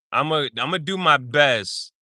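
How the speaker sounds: ordinary talk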